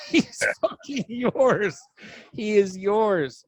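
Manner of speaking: high, slow, laughing through the words; cracking up while saying it. Retold or imitated. Retelling text to Puerto Rican friend.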